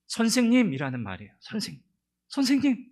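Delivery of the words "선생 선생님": much higher, louder gasped cry; startled cry. Acted. Mary suddenly recognizes her teacher, astonished cry. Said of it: "저 깜짝 놀란 거죠".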